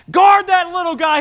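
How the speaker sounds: imitated shout from the sidelines; mock crowd shout. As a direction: call out lightly like a heckling spectator. Crowd calling out at basketball game.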